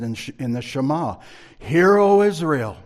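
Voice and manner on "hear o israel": solemn scripture. Acted. Quotes the Shema.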